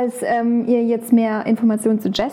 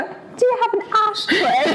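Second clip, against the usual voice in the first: put-on American accent in German; 12 semitones higher, slower than usual. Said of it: "Ich sag nur".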